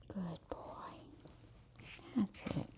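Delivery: soft breathless whisper to the dog; whispered praise. Real, not acted. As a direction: whisper praise to keep him calm.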